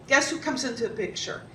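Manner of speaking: plain reading voice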